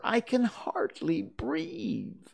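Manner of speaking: very high, drawn-out complaint; slow, high dormouse whine; squashed Dormouse. A narrator's reading. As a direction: squeeze the words out slowly, high up.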